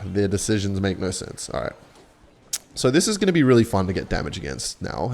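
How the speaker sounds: normal talking voice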